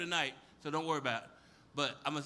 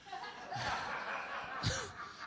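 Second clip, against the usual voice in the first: laughing; 12 semitones higher, 6 dB quieter than usual. Real, not acted. Laughs after punchline.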